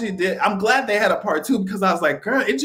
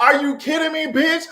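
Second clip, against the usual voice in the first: mock outrage; 10 semitones higher than usual. Retelling reading the comments, high mock disbelief. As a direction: play up the shock. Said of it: "I was like".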